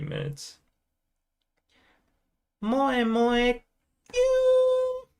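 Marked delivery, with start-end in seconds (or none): 2.6-5.1 very high, drawn-out cutesy Japanese voice; squeaky cute Japanese voice. Acted. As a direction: put on a sugary anime-style squeak. Performing cute Japanese catchphrase.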